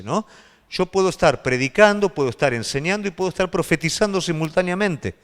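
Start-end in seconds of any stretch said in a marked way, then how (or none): none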